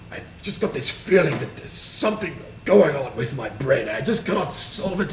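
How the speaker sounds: high, loud, agitated put-on voice; hammed-up distressed visitor; troubled character. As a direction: plead your strange problem to the healer. Troubled character explains himself to shaman.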